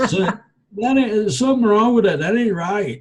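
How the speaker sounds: high, squeaky disbelief; mock shocked disbelief. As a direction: play your younger self stunned by what you see. Retelling his shock at alien beings.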